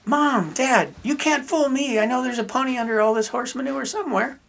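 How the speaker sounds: put-on high-pitched, eager kid voice for the punchline; gleeful little boy. Optimistic son telling parents about the pony, gleeful. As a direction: play a delighted boy who won't be fooled.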